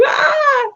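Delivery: high, sudden excited squeal; giddy squeal. Retold or imitated. Recalling how over the top she used to be, squealing. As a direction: let out a giddy shriek of joy. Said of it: "I'd be like".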